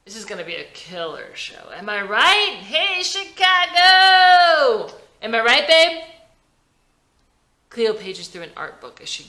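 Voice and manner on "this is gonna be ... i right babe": loud, high, brash showman voice; rowdy rock star. A narrator's reading. Howie hyping the band before a Chicago show, loud brash rocker.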